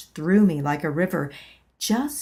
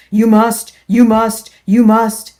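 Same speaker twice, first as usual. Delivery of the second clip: insistent demand; 3 semitones higher, 12 dB louder than usual. Acted. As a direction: pound each must. Insistent inner voice.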